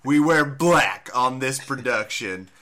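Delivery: slow, light Western drawl on a boss; mock Western-accented boss laying down rules; hint of a Western accent. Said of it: "why he has a Western accent".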